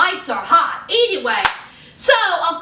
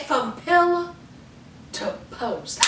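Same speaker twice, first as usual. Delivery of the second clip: trailing drop to a near whisper on post; dramatic drop; 7 dB quieter than usual. Retold or imitated. Blac Chyna dropping her big line, voice sinking. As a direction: let post fall away almost unvoiced. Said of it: "Blac Chyna said".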